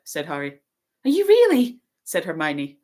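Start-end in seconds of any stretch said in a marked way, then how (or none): 1.0-1.8 very high, loud amazed question; astonished. A narrator's reading. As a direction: gasp it in disbelief. Hermione astonished meeting Harry.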